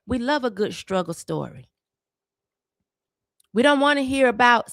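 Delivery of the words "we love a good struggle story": dropped low and softer, sneering; mock the media's fake sympathy, low and hushed; low mocking parody, a notch quieter. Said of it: "Here it go".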